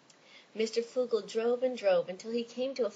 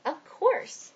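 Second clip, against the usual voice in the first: offended; 8 semitones higher, 7 dB louder than usual. Acted. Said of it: "looking offended".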